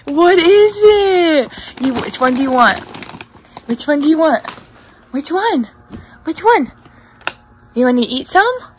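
high-pitched cooing questions; coaxing pet talk. Real, not acted. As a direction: tease your pet with a choice of treats. Offers pet treats.

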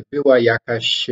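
ordinary delivery

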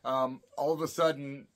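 normal talking voice

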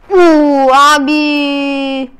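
long, loud drawn-out awe; amazed. Real, not acted. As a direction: stretch it out in wonder. Amazed at a satisfying video.

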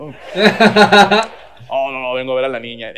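quick, laughing father-in-law voice; a touch of sheepish suegro, rushed out; laughing denial. Retold or imitated. Suegro laughing off being kicked out, fast and loud.

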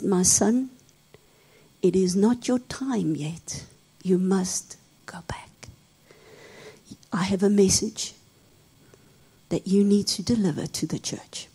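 soft, low, tender command; gentle Lord. Acted. Jesus gently tells him to go back, softly. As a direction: speak softly, like a loving father.

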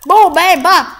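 loud, high, punchy bursts; high, hard shooting call-outs; shouted gunshot words. Real, not acted. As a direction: punch each word out like a shot. Voicing gunshots while firing.

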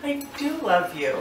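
weary, fed-up I do love you; exasperated. Real, not acted. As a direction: sigh it out like the tenth time.

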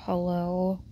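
low-pitched hello; deep-set, understated greeting; low, attractive voice. A narrator's reading. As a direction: say it low in the throat. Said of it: "said in a low, slightly attractive voice".

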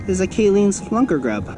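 usual speaking voice

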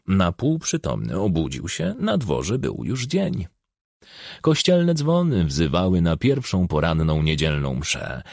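ordinary delivery

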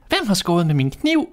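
a small man wanting to know who used his knife; high-pitched dwarf, put out; indignant dwarf. A narrator's reading. Sixth dwarf questions.

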